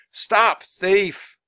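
high, loud mob cry; shrieking. Acted. Crowd chasing down the alley, shrieking. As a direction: shriek the alarm after them. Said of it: "Everyone kept shrieking".

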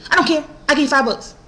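imitated high, insistent bargaining; pushy woman impression. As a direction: mimic her brushing off the objection. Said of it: "That's how she was talking".

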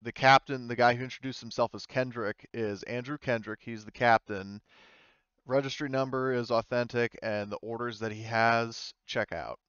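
ordinary delivery

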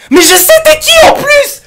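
very high, loud shriek; excited recognition. Real, not acted. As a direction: shriek it as it dawns on you. Suddenly recognises the caller.

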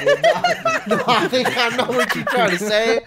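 breaking into laughter while refusing; laughing. Real, not acted. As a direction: laugh through the no's, loud and high. Teased to say stickers in accent.

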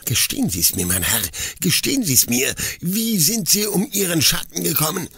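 rising, demanding forester; angry demand. A narrator's reading. Forester angrily demands how the shadow was lost. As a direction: press him for the truth. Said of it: "Er fuhr mich zornig an".

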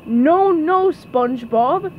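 loud opening no, then slow name; scolding. Acted. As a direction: scold like an exasperated teacher. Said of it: "he scolded".

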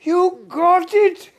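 high, loud squeal of joy; imitating an overjoyed maid, shouting high; excited maid. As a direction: cry out the good news, shrill with excitement. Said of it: "she was so excited".

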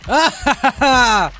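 high, loud "ha" laugh; loud villainous cackle. Acted. Killer chasing survivor, loud cackle. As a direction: cackle wildly on the attack.

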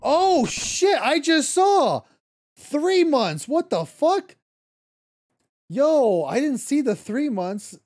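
high, loud shocked exclamation; shocked outburst. Real, not acted. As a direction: blurt it out, stunned and loud. Just noticed three-month gift sub.

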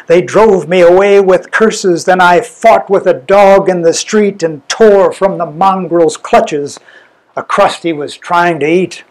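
ordinary delivery

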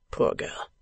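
Poor girl murmured unvoiced, very soft; Poor girl breathed as if thinking aloud; murmured to himself. A narrator's reading. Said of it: "as if merely passing through his own mind".

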